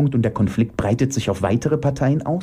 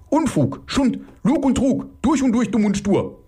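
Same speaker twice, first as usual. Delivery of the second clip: high, angry outburst; 13 semitones higher than usual. Acted. Ulf cursing through his fear, angry. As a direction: fling out each abuse in raised pitch. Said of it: "brummt Ulf Wut durch Furcht".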